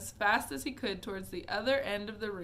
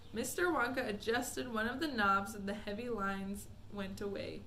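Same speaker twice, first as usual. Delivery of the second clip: ordinary delivery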